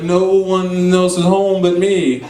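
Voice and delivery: long drawn-out words; slow mock calling. Brother correcting sister through the door, drawn-out call. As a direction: stretch each word out as if calling over.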